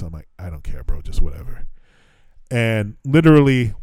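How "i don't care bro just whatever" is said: low, softer retold surrender; resigned. Worn-out traveler accepting any later flight, resigned. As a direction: give up, dropping the voice right down.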